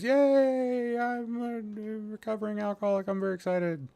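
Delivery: high sarcastic fake excitement; mock cheer. Host mocking his own sobriety to viewers, fake cheer. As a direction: sell the joke with fake glee.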